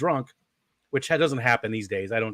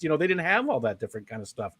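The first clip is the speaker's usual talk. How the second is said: ordinary delivery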